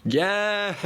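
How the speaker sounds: high, drawn-out celebratory yes; real burst of triumph; triumphant cheer. Real, not acted. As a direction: cheer a win, pitch shooting up. Celebrates passing the test.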